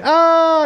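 put-on short high shriek of a fleeing kid; mock scream. Six-year-olds flee the new coach, high mock shriek. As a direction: shriek like a villager running from a troll.

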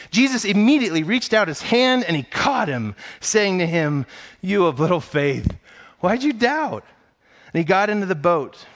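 high, loud, building Bible reading with full force; dramatic scripture reading. Acted. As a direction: preach the passage like it's happening now. Reads scripture with rising force.

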